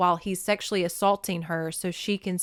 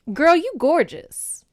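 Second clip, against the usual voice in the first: higher, louder, slow sassy compliment; sassy Poseidon; 8 semitones higher, 7 dB louder, slower than usual. Retold or imitated. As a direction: hype her up like a bestie. Poseidon admiring her.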